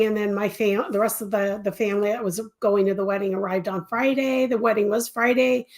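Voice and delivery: ordinary delivery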